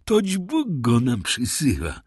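high, gloating reply, slowing at the end; terrible smile. A narrator's reading. Wife to husband as their victim returns, terrible smile. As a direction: say it through a dreadful smile. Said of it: "ze straszliwym uśmiechem".

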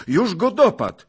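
loud, high running commentary on a fight; thrilled, yelled blow-by-blow; excited calling. A narrator's reading. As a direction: cheer on the fighter in shouts. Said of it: "wołał pan Zagloba".

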